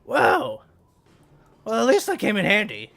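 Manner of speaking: high, loud surprised voice; surprised NPC. Acted. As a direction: react with amazement.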